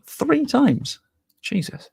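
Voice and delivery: disbelieving, pitch shooting up; shocked. Real, not acted. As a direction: gasp it out in disbelief. Reacting to a chat message.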